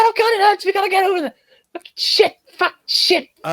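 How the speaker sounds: high panicked babble; high-pitched panic, jokingly replaying the moment; frantic panic. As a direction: spiral into a frightened rush. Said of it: "I should have fucking said".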